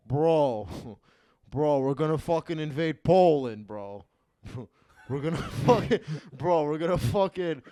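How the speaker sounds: loud, hyped-up Jersey Italian bro; Jersey Italian accent. Retold or imitated. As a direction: bark it like a Jersey guy with a laugh. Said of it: "talk like a guy from Jersey".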